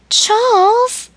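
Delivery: high, loud outcry of his name; shocked cry. A narrator's reading. Emily crying out to Charles in shock.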